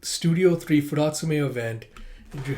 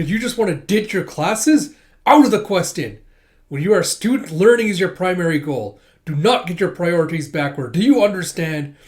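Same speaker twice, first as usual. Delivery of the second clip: loud, raised scolding lecture; stern telling-off; 3 semitones higher, 7 dB louder than usual. Retold or imitated. Senior lectures junior on priorities, stern and loud. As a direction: lay down the law, loudly.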